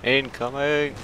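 high, sung-out warning call; mock naval warning. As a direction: play a sailor calling incoming fire. Calling out enemy fire.

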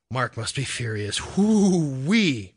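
whooping with amusement; amused, laughing. A narrator's reading. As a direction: let out a drawn-out amused whoop.

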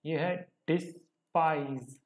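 डिस्पाइज spoken slowly and carefully, syllable by syllable; teacher spells out डिस्पाइज slowly for students; slow pronunciation demo. Shown as a demonstration. Teacher shows students how to say डिस्पाइज, slowly. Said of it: "इसकी जो pronunciation है मैं यहाँ लिख देता हूँ".

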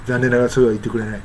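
normal talking voice

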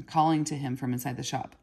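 ordinary delivery

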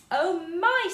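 high, loud delighted gasp; thrilled at the bag of toffee. A narrator's reading. Moonface delighted at the bag of toffee, excited. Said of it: "looking with great delight".

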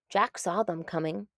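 ordinary delivery

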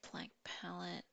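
whispering. Real, not acted. Reading item name aloud.

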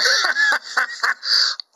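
high delighted laugh. Real, not acted. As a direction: burst out laughing, high and loud. Laughs after announcing.

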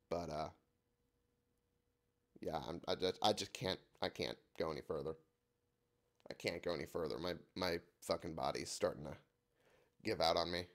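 soft, fading, drained voice; real fatigue, voice giving out; sick and worn out. Real, not acted. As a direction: let the energy drain away. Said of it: "My voice is starting to go".

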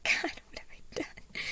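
whispered, breaking-down horror; tearful. A narrator's reading. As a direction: whimper it with almost no voice. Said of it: "She broke down into tears".

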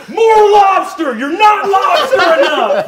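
very high, loud drawn-out shout; drunken party yell. Acted. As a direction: holler it across a wild party. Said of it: "you see that [name] is going".

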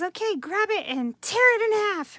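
high, loud, cheerfully bossy teacher; imitating the teacher. Teacher tells class to tear favorite paper, high and chirpy. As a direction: sing out the shocking order brightly. Teacher giving shocking order.

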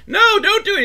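high, loud panicked plea; mock scream. Wife pleading with drunk husband, mock scream. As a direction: scream at him to stop. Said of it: "she's all screaming".

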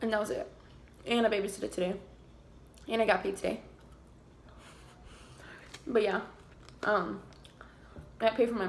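eating between words; talking while chewing fries. Real, not acted. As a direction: mouth partly full. Seen 0: Fry held at and into mouth while speaking, chewing between bites.

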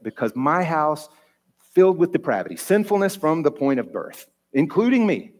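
rising preaching build; rising sermon emphasis. Acted. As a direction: climb in pitch like a preacher.

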